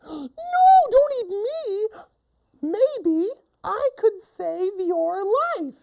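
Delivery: very high squeaky plea; little mouse pleading. Acted. Mouse begging not to be eaten, high squeaky pleading. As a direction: beg in a tiny falsetto. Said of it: "the little mouse says".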